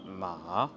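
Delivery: slow MA dipping low, then rising; hesitant, drawn-out ma; third tone, hesitation. Shown as a demonstration. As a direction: drag ma down and back up. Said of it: "un peu l'émotion de l'hésitation".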